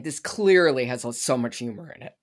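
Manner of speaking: ordinary delivery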